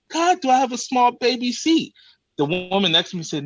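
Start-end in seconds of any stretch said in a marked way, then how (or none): 0.1-1.9 higher, incredulous complaint; mock dismay. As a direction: whine in disbelief at the seatbelt. Seatbelt won't lock.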